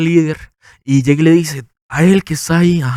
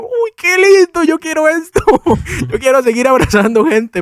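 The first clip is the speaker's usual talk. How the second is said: gushing delight; 12 semitones higher than usual. Retold or imitated. Squealing with joy after a stranger's hug. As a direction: gush like a thrilled kid. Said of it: "y yo".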